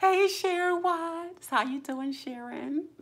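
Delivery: high, loud, stretched hey; excited greeting. Real, not acted. Streamer welcoming Sharon Watts to the stream, high and excited. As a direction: call out to a friend with delight.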